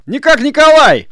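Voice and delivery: very high, loud holler; ringing long-range shout; shouted recognition. A narrator's reading. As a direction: call out to someone you know. Shouted exchange across a distance.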